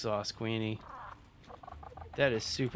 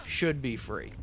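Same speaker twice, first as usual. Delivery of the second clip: "should" stressed at the start, then falling away; emphasis on "should". Real, not acted. Renter in the sunny desert complaining of bills, "should" emphasized. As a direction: restart firmly on "should". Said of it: "emphasis on the word should".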